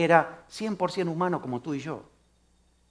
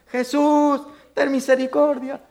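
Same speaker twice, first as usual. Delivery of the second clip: pleading cry; 6 semitones higher, slower than usual. Acted. Blind men crying out.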